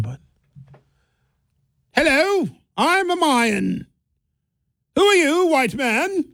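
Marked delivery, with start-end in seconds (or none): 1.9-6.3 high, slow, posh royal voice for a Mayan; mock Mayan accent. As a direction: play a Mayan chief in a plummy British royal voice. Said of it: "I wish I could do an accent for an Incan or a Mayan".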